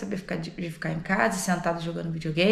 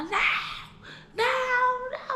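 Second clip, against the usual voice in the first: very high mock wail; high, stretched cries of no; child's frantic refusal. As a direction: imitate a panicked child refusing. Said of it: "ela só tava gritando".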